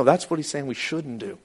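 ordinary talk

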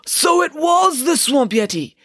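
gasp, then loud, high, slow cry; excited exclamation. A narrator's reading. Parker thinks he has found the culprit, exclaiming. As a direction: gasp and shout out the revelation. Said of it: "exclaimed Parker".

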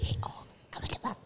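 soft whispered model for a student. Shown as a demonstration. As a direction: whisper it gently, barely audible. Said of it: "You gotta whisper it".

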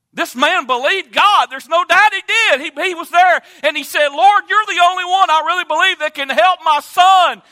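loud, high preacher's build; full-force preaching. Acted. Preacher telling the congregation of the father's faith, loud and high. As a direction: preach it, climbing in volume.

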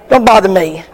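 usual speaking voice

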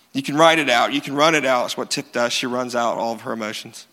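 usual speaking voice

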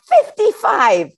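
put-on high, slow kid answer; child's answer. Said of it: "another little boy says".

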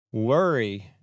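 normal talking voice

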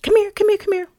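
high, excited calling in. Pretend. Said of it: "Let me say it again".

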